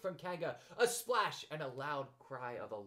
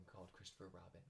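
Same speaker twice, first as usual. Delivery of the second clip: ordinary delivery, nothing marked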